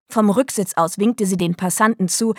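ordinary delivery

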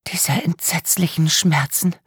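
breathy whisper, slow and haunted. A narrator's reading. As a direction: whisper the words as if reliving them. Victim whispers in shock.

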